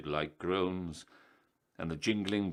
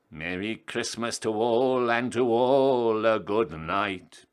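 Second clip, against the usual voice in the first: gleeful cackling Jack; 4 semitones higher, 8 dB louder than usual. A narrator's reading. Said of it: "Jack cackled".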